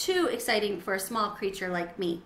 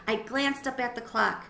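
ordinary delivery

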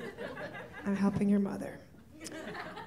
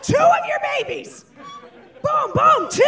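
much higher, loud triumphant shout; shouted brag; 13 semitones higher, 8 dB louder than usual. Acted. Boasting to crowd she could birth two of cousin's babies. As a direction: yell it like a victory.

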